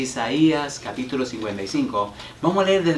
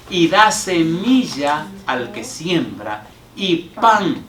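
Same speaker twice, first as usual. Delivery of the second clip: slow, swelling, louder toward the end; declaimed scripture reading; 2 semitones higher, 5 dB louder, much slower than usual. Acted. Pastor to congregation, proclaiming Isaiah 55. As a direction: build the verse to a proclaiming peak. Reads Isaiah 55 with rising force.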